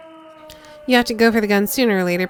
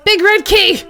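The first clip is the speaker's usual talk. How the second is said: loud; 13 semitones higher, 8 dB louder, slower than usual. Acted. Woman screaming code.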